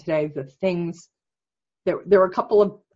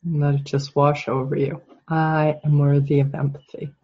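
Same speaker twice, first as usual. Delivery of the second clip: calm, measured guide with long silences; guided meditation; 2 semitones lower than usual. Real, not acted. Coach leading client's inner affirmation, calm meditation voice. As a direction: leave long pauses for it to sink in. Leads guided self-empathy exercise.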